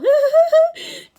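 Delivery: high falsetto giggle; loud, put-on high titter; forced giggle. A narrator's reading. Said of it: "forced a weak giggle".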